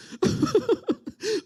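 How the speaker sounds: high-pitched fake sobs; hammed-up burst of weeping; mock crying. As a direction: burst into imitation tears. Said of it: "immediately starts crying, and she goes".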